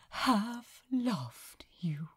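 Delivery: whispered, slow and breathless. Acted. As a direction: barely breathe the words out, overcome. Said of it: "a quick gasp for breath".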